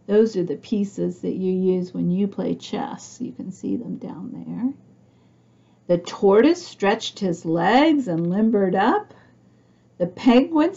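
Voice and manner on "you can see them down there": breathy aside whisper; hushed aside to the little listener. Real, not acted. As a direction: lean in and whisper it.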